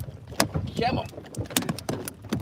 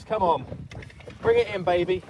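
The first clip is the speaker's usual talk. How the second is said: usual speaking voice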